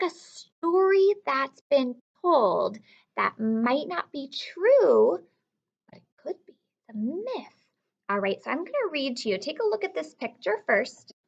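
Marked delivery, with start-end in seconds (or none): 5.9-7.7 soft, secret whisper; mysterious hushed aside. Acted. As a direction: lean in and whisper it.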